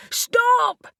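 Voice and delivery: frantic yell to stop the attack. A narrator's reading. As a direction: burst out with it. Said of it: "Reuben yelled".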